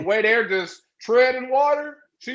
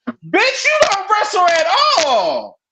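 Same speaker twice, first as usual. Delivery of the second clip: exasperated outburst; 11 semitones higher, 7 dB louder, slower than usual. Real, not acted. Mocking Rusev.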